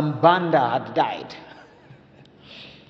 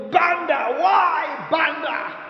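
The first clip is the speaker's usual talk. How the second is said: mock wailing; 11 semitones higher, slower than usual. Mourner hamming up grief over the coffin, mock wailing. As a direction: wail his name like a hammed-up mourner. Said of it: "he went to hug the coffin".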